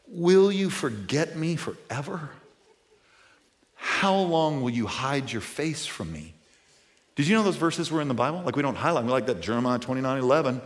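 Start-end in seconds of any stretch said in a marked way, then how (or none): 0.1-6.3 hushed lament. Acted. Reading David's lament, hushed.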